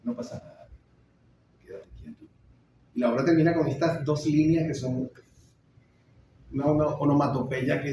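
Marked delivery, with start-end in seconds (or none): 0.0-2.2 soft, breathy soothing murmur; hushed reassurance dying away; hushing. Acted. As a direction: hold him back gently, almost without voice. Mother restraining her desperate son.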